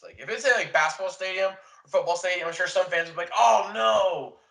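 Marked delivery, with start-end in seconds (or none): 3.4-4.4 loud, higher mock dismay; mock fan groan. Imitating disappointed fans at the news, loud mock groan. Fans reacting to volleyball stadium.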